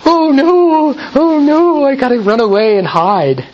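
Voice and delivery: high, squeaky panicked cry of a frightened lion; hammed-up terrified squeal; mock scared lion. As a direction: play the lion as a silly coward running off. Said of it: "do you think the lion would go".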